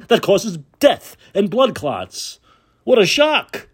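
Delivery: high, biting mockery; sarcastic rant. Real, not acted. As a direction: sneer it out, pitch rising.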